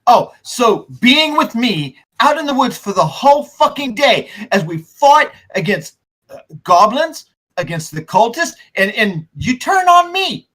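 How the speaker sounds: high, incredulous rant; indignant Coulson. Acted. Loyal companion berating those who turned on him, indignant. Betrayed NPC rants at party.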